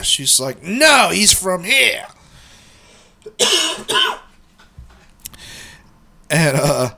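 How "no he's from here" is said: slow, high imitated holler; mock yelling back. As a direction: mimic a wife calling back, stretching each word. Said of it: "they start yelling back and forth".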